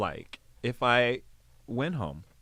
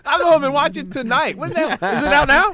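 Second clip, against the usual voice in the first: high, loud and keen; eager; 13 semitones higher, 11 dB louder than usual. Real, not acted. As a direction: jump at the invite. Excited eager reply.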